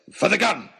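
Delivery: gruff, urgent outburst; wild hunter. A narrator's reading. Wild Oxshott explains himself to the crowd, urgently. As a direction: blurt it like a half-mad hunter.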